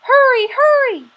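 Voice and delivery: high urgent grandmother call. Acted. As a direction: urge him on from the doorway, high. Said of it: "she called".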